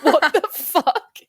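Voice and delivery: slow, high, laughing disbelief; incredulous laugh. Retold or imitated. Stunned at the date's reply, slow and laughing. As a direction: drag out the shock with a laugh.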